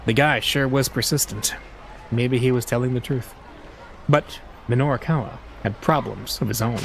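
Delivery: usual speaking voice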